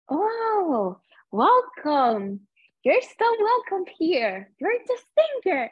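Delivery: high, sing-song welcoming host voice; gushing welcome. Retold or imitated. Voicing the chill welcoming hosts.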